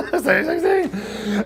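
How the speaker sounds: high, slow, laughing disbelief; laughing echo. Retold or imitated. Friend echoing Lex's greeting, laughing in disbelief. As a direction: repeat it drawn out while cracking up.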